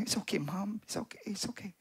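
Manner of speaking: unvoiced, soft whisper; whispered reassurance. Hurt boy whispering to his mother that he is fine. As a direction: whisper to calm her.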